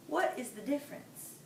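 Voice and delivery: ordinary delivery, nothing marked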